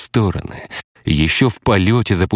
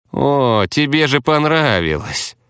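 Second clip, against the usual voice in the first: higher, sneering taunt; nasty smirk; 7 semitones higher than usual. Acted. The hypocrite mocking Romeo about the deed, smirking. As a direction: grin nastily at him. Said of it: "неприятно ухмыльнулся лицемер".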